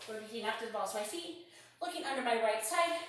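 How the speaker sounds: ordinary talk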